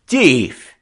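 high, loud one-word jeer; taunting. A narrator's reading. French skipper goading the indignant boy, taunting. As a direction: fling it back as a French-accented taunt. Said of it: "the Frenchman taunted back".

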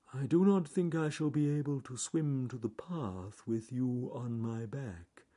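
laboured, measured, sinking away. Acted. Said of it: "said John Slow Tortoise".

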